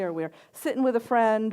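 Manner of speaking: ordinary talk, nothing marked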